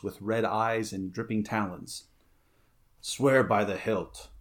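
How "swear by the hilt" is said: slow, solemn command; measured captain's order; demanding. A narrator's reading. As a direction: demand an oath, weighing each word. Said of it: "Conan demanded".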